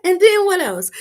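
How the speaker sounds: high, loud eager squeal; giddy squeal. Retold or imitated. Pressing him for the rest, giddy high squeal.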